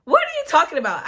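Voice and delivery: high, loud and fast disbelief; shocked outburst. Retold or imitated. Told she has chlamydia, loud shocked blurt.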